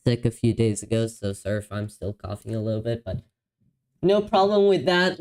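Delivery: normal talking voice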